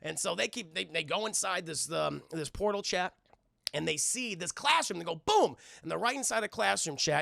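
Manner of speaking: ordinary talk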